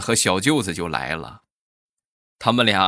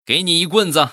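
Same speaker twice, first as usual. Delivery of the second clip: higher, louder gloating punchline; gloating shout; 6 semitones higher, 4 dB louder than usual. Acted. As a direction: shout it as you swing. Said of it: "就跟他说".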